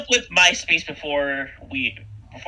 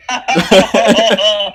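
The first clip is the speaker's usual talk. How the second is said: laughing; 3 semitones higher, 10 dB louder than usual. Real, not acted. Laughs at savage joke.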